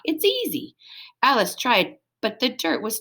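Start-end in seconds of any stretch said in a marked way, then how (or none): 0.0-0.7 dog talk. Acted. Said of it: "said Stanley in dog talk".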